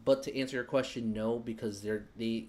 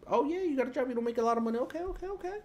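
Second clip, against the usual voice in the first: very high, loud squeaky parody of a lender; mock eager lender; 13 semitones higher, 5 dB louder, faster than usual. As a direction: play an over-eager lender in falsetto. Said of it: "We're like".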